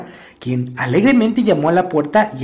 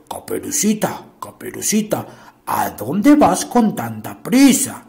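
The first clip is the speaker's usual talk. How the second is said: wolf voice; 6 semitones lower than usual. Acted. Wolf calls to Little Red.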